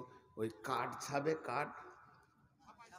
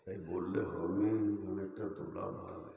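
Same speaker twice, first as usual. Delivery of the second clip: deep put-on voice; 8 semitones lower than usual. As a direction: drop the voice deep and self-important. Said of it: "মোটা গলা করে বলছি".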